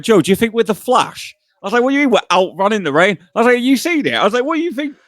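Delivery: high-pitched disbelief at a silly idea; incredulous mockery. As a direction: scoff at him, voice way up. Mocking his friend's idea.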